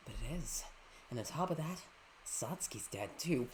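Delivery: low, soft, sinister villain. Acted. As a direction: taunt them quietly. Villain voice taunting.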